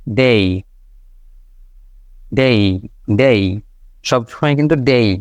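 ডেই with a glide to ই; dei ending. Shown as a demonstration. Teacher drills the ডেই glide. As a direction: end on ডেই, never plain ডে. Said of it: "ডে কিন্তু না".

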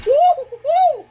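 high, loud woo woo woo; loud whoops. Real, not acted. Cheering as sugar pours in, loud whoops. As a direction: whoop with glee.

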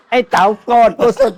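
can't hold back the laugh. Real, not acted. Telling the interviewer about the angry actor, laughing. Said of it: "laugh heard".

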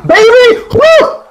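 very high, loud whoops; excited cheering. Real, not acted. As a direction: cheer the win at full voice. Team scores, cheering.

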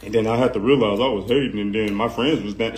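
low dopey imitation; mock his rambling; mocking the rapper. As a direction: parody his words as stupid. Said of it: "Not no".

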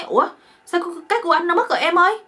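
high, loud, fast panic; hammed-up alarmed yelp; acted shock. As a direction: cry out as the baffled husband. Husband discovers it is gone.

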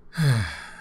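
a short falling sigh. Acted. Settling in to comfort the tired roommate, sighing. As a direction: let out a sigh before getting serious.

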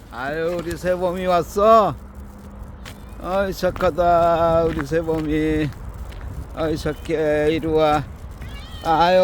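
high pet talk calling the dog Saebom. Real, not acted. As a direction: coax the dog over up high. Man calling dog Saebom for walk.

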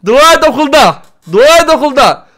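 loud, high tough-guy threat; mock threat yell. Boy threatens rival in front of classmates, loud swagger. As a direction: bark it like a schoolyard bully. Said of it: "Ya diyorum".

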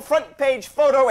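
high, loud, slow on "front page photo"; hammered emphasis. Acted. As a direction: drive each word of "front page photo" home. Lawyer hammering home stakes.

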